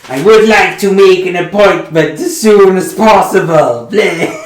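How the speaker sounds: loud, high, demanding. Acted. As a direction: demand it loudly.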